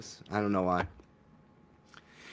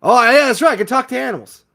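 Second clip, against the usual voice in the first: much higher, much louder gleeful cry; excited realization; 11 semitones higher, 9 dB louder, faster than usual. Acted. Guest remembering she can talk to animals, gleefully loud. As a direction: shout it like a sudden happy discovery.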